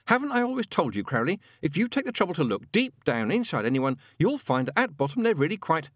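high, eager angel voice; earnest Aziraphale. A narrator's reading.

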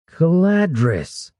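high, drawn-out cry of a name; exclaimed. A narrator's reading. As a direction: exclaim it slowly, pitch leaping up. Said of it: "Brannis exclaimed".

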